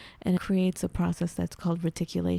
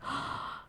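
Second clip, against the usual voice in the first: gasp; 11 dB quieter than usual. Retold or imitated. Reaction to seeing the cover.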